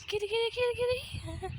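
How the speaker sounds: high sing-song kitty call; calling a cat. Real, not acted.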